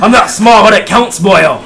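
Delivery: loud, high dwarf shout; indignant shout. Acted. Dwarf bangs chest denying he's small, shouting. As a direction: bellow it while beating your chest. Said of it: "Kinda bangs his chest".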